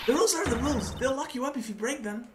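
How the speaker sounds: high-pitched dialogue voice; a hint of a high character voice; lightly voiced game character. Voicing game dialogue, higher character.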